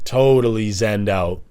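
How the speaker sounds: ordinary talk, nothing marked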